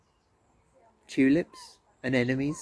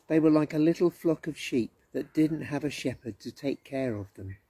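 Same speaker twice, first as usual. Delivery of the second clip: plain reading voice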